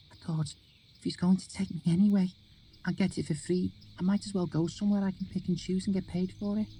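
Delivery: put-on high, bitter girl's voice; young girl voice. A narrator's reading.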